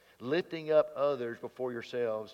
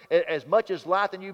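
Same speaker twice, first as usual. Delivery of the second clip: read out loud; 5 semitones higher, 8 dB louder than usual. Shown as a demonstration. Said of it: "Remember that scripture word?".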